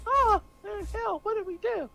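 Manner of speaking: high, thin dismayed Canadian voices; two bewildered Canadians reacting to hell, squeaky and high.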